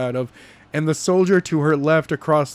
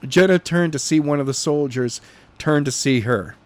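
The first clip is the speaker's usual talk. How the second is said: plain reading voice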